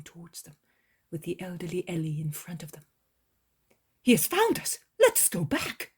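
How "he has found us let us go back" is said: high, loud, hurried alarmed report; Peter, urgent and breathless. A narrator's reading. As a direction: Peter bursts in with bad news.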